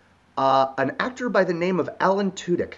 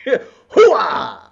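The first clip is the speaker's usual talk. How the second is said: loud, high Hooah yell; Al Pacino impression; 6 semitones higher, 8 dB louder than usual. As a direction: bark it out like Pacino. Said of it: "old school Al Pacino".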